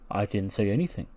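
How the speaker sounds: low, very soft acted denial; quiet denial. Keith answering his teacher's question, quietly denying it.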